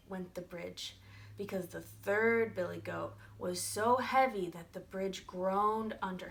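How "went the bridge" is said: plain reading voice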